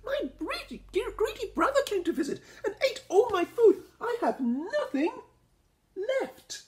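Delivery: very high squeaky voice with laughter; Rabbit. A narrator's reading. Rabbit tells Noko about her greedy brother, laughing high. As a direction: giggle through the complaint.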